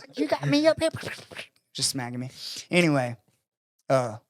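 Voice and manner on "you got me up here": much higher, louder mock angry mom; angry mom yelling. An embarrassed mom yells at her son in the station, much higher and louder. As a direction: shriek at your son like mom just got dragged out.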